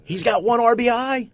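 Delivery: high, loud ranting voice; Francesa rant impression. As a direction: rant like an outraged radio host.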